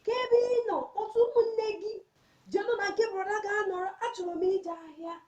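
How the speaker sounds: put-on high-pitched mother calling her child. Mother calling her daughter to bring the siblings, high calling voice. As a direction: call out high to your child. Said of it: "is the voice of my mother".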